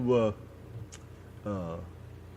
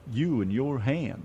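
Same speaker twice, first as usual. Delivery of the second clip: normal talking voice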